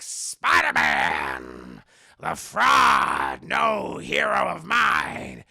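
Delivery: loud, slow, drawn-out goblin taunt; Willem Dafoe. Acted. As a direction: bellow it as a theatrical supervillain. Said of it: "the Dafoe voice because I like it better".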